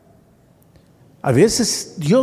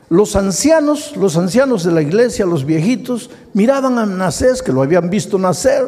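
preaching; 6 semitones higher than usual. Real, not acted. Preaching at full force.

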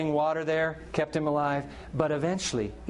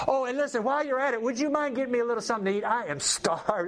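hungry prophet; 7 semitones higher than usual. Retold or imitated. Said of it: "prophet continues asking widow".

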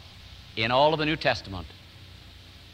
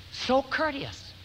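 tender wonder; 8 semitones higher, 5 dB quieter, slower than usual. Real, not acted.

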